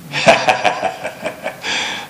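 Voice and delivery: a quiet chuckle under the breath; a little self-mocking laugh, barely voiced; soft breathy laugh. Real, not acted. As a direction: laugh gently at yourself. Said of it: "I'm laughing because I'm thinking of myself".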